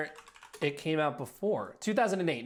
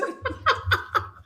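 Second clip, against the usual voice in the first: high laugh; 4 dB louder than usual. Real, not acted. Host laughs at contestant.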